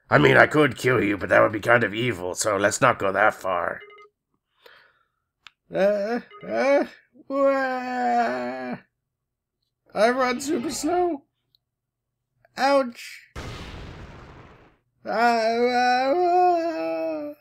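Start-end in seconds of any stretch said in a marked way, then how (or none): 5.7-17.3 high mock crying. Girl character crying.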